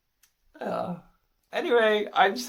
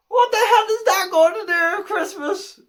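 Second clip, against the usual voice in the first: high, loud indignant demand; hammed-up exasperation at an absurd offering; mock outrage; 8 semitones higher, 6 dB louder than usual. Saint Peter outraged at the knickers.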